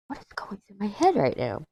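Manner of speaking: hushed, baffled whisper to yourself; real self-questioning whisper; whispered aside. Real, not acted. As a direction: whisper it under your breath.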